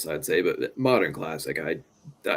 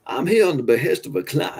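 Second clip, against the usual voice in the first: put-on Daniel Craig's Southern accent; 7 semitones higher, 5 dB louder than usual. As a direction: imitate an over-the-top Southern accent. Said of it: "gonna talk like that".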